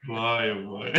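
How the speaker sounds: high laughs round a sigh; real laughter; laughing. Real, not acted. Laughing at the story's end.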